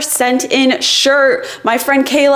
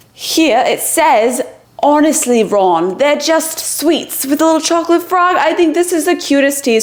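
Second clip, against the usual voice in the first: higher, put-on British accent; 5 semitones higher than usual. Shown as a demonstration. Reading her t-shirt slogan to viewers, British accent. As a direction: read it out in a playful British accent. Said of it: "let me know in the comments how my accent is".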